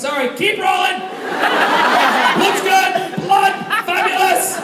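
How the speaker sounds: high, hammed-up shout; calling out on set. Actor urging crew on after injury, high shout. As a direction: holler brightly to keep the crew going.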